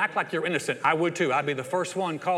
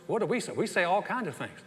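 ordinary delivery

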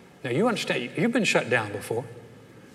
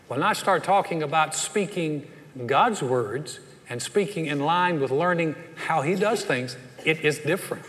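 normal talking voice

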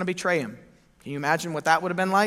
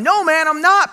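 much higher, loud snap; defensive retort; 11 semitones higher, 10 dB louder than usual. Acted. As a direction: fire it back defensively. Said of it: "Peter retorted".

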